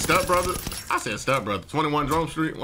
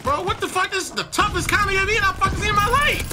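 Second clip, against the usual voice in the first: much higher, stunned disbelief; shocked outburst. Real, not acted. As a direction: blurt it out in real shock. Shocked by enemy counter UAV.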